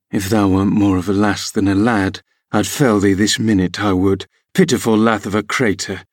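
ordinary delivery

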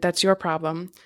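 usual speaking voice

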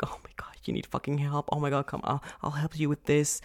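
hushed pitying whisper; tiptoeing whisper. Retold or imitated. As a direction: whisper like someone treating you fragile. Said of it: "don't tiptoe around me and be like".

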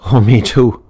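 cried out. A narrator's reading. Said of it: "cried Pat".

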